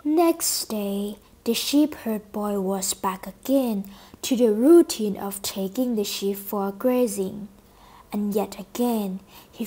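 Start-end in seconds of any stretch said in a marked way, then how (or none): none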